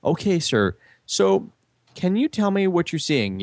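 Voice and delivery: a hint of a scripted call-centre rep; mimicking phone agent. Retelling his call, he voices the phone company agent politely.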